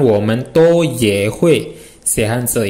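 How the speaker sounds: slow, even "Women ye to hui xie hanzi"; slow Mandarin model. Shown as a demonstration. Teacher repeats the sentence slowly for the class. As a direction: read it out carefully for learners.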